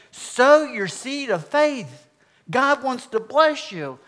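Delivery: high, loud, drawn-out preacher proclamation; radio preacher. Retold or imitated. Mimicking a prosperity preacher on the car radio, loud slow proclaiming. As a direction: mimic a radio evangelist, stretching each phrase.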